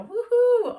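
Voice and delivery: very high, loud joyful whoop; pretend celebratory whoop. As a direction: let out a huge happy cheer. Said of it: "And you're like".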